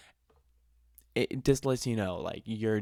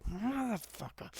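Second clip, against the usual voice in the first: mock exasperation; 12 semitones higher, 5 dB quieter than usual. Storyteller reacts to the sign, drawn-out exasperated curse. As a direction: stretch it out in comic disbelief.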